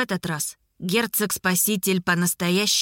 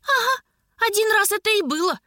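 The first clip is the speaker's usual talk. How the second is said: high, quick, scoffing retort; scornful snort; 11 semitones higher than usual. A narrator's reading. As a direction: scoff at your uncle's claim. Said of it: "фыркнула племянница".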